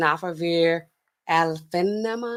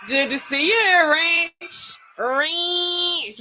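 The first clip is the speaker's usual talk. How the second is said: high, drawn-out name call; excited greeting; 10 semitones higher, much slower than usual. Real, not acted. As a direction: sing out a delighted hello to a donor.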